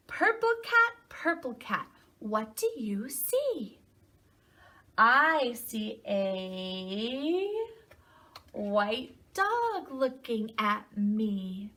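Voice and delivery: slow, lilting read-aloud with stretched words; storybook reading to children. A narrator's reading. Teacher reading a picture book to children, slow singsong storytelling. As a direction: read slowly, swooping up on key animal names.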